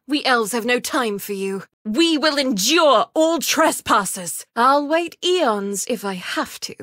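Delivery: usual speaking voice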